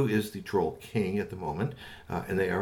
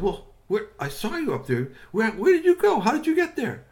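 high, loud astonished questions from the big fellow; startled shout of where did you go; surprised Strongbones; 11 semitones higher, 9 dB louder than usual. Acted. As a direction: call up in loud disbelief. Strongbones surprised to see friend.

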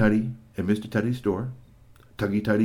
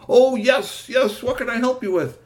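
much higher, louder helpful voice; Marvel's voice; 13 semitones higher, 6 dB louder than usual. Acted. Marvel recognizing GP on the phone, loud and high. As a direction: answer brightly.

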